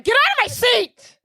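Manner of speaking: harsh loud barked order; a rude dad roaring from the doorway; gruff father yelling. Retold or imitated. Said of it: "And he's like".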